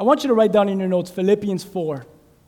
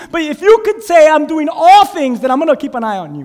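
rising preaching; 8 semitones higher, 6 dB louder than usual. Acted. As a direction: lift the room as you preach. Preacher rising to loud delivery.

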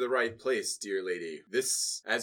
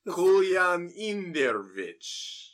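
Kolyan Indirovich, slow and higher with a Russian accent; better Russian accent; 7 semitones higher, 7 dB louder, much slower than usual. Shown as a demonstration. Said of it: "Say it with a better accent".

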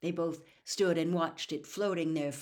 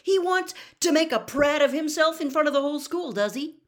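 indignant; 11 semitones higher, 12 dB louder than usual. Acted. Harry angry at Ron's jealousy.

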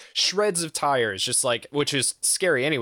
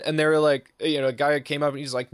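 ordinary talk, nothing marked

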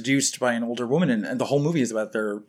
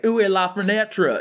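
higher, hammed-up Texan mangling of French; badly Texan-accented French; 8 semitones higher, 4 dB louder than usual. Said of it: "It's not that bad, right?".

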